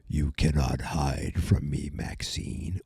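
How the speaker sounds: very low, slow villain voice; Vecna. Retold or imitated. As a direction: menace your prey in a deep slow voice, sinking away at the end. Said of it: "you can hear Vecna".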